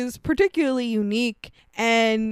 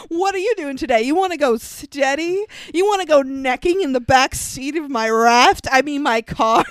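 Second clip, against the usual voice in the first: mock fifties heartthrob, higher and a bit loud; stilted fifties actor; 4 semitones higher, 3 dB louder than usual. Mocking a stilted fifties boyfriend line, hammed-up. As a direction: ham up the awkward date proposal. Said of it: "it's awkward and stilted, but also, like, loud".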